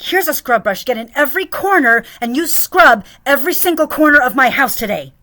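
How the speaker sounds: loud, high mocking boss voice; mock her nagging orders; hammed-up demanding boss; bossy employer. Imitating boss demanding scrubbing again.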